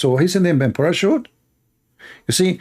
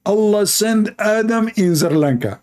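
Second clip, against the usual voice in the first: ordinary talk, nothing marked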